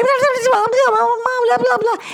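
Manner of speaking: high, rushed mock babble of a child; excited kid gabbling, mock high; fast child chatter. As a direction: babble like a kid rushing in the door. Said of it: "they're talking so fast".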